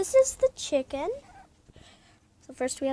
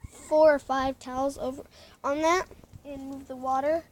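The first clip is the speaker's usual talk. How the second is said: ordinary talk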